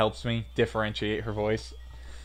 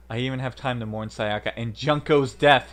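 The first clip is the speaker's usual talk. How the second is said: ordinary delivery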